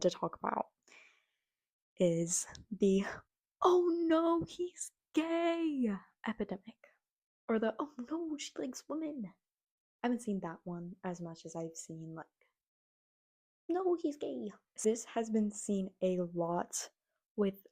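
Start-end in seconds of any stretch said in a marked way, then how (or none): 3.6-5.9 higher, louder mock wail; mock dismay. Mocking dismayed fans. 7.8-9.2 higher, softer mock lament; mock dismay. Mocking dismayed fans. 13.7-14.5 higher mock whine; mock despair. Vlogger mocking disappointed fans, higher whine.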